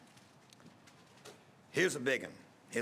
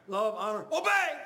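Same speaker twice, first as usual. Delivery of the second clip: obey bursts out much higher and louder; nervous bride shouting her wedding vow; nervous blurted shout; 8 semitones higher, 4 dB louder than usual. Retold or imitated. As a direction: blurt the vow far too loud out of nerves. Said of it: "She said it so loud".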